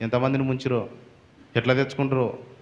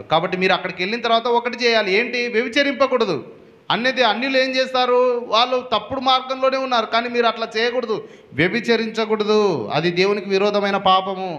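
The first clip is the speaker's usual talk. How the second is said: fervent preaching; 6 semitones higher than usual. Acted. Preacher exhorts the congregation.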